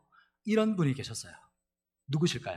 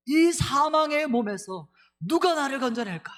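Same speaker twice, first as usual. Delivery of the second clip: much higher, louder and slow, desperate outcry; anguished cry; 10 semitones higher, 5 dB louder than usual. Retold or imitated. Pastor acting out Paul crying out from his body of death. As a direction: wail it as a soul in torment. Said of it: "이렇게 막 절규하는 목소리죠".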